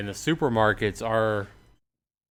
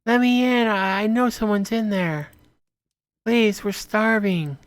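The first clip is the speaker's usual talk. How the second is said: starving stranger begging at the door; desperate woman begging; 13 semitones higher, 4 dB louder than usual. Acted. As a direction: beg to be let in, pitched way up. Voicing a starving woman outside.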